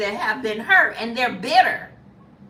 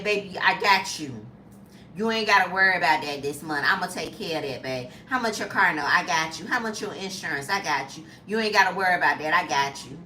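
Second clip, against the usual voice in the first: providing man; 7 semitones lower, faster than usual. Retold or imitated. A man promising his woman he'll pay her bills, lower reassuring voice. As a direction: croon it like a man taking care of his woman. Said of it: "men coming into your life and saying".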